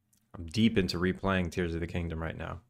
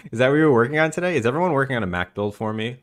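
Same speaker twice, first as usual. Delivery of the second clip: excited; 4 semitones higher, 7 dB louder, much faster than usual. Real, not acted. Teasing hopes for Mac builds.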